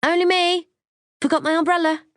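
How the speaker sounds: high singsong call through the house; high-pitched, projected announcement; loud call out. A narrator's reading. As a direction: call out brightly to someone in another room. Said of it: "shout loudly".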